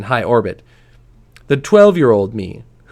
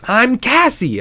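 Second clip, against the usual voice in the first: much higher mock holler; a retold annoyed girl's yell, pitched way up; 12 semitones higher, slower than usual. Daughter correcting her teasing father, mock holler. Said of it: "I'd holler at him".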